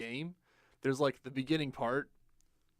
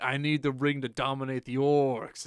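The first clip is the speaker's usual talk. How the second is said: loud, hammed-up villainous declaration; grandiose demand; 7 dB louder than usual. Player demanding the ring back from Shelob, grandiose. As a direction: proclaim it, stretching the last word.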